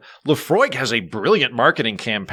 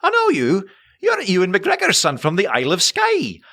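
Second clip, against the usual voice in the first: put-on high-pitched, insistent Scottish accent; Scottish lady; 7 semitones higher than usual. Scottish lady at a wedding recognises him, high insistent Scottish accent. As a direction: accost a stranger in a high Scottish voice. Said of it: "a lady came up and went".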